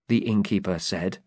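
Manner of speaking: ordinary delivery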